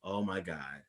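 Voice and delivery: low, very soft realization; hushed recalled awe. Retold or imitated. Retelling the moment Spider-Man appeared, hushed awe. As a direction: drop right down, quietly stunned. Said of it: "and I was like".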